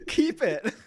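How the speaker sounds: high, loud playful echo; laughing quote. Retold or imitated. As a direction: echo the catchphrase through laughter. Repeats the funny line, laughing.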